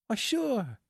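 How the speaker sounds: very high woman's character voice; Marcia's voice. A narrator's reading. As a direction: agree readily in a high, falling tone. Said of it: "repeated Marcia".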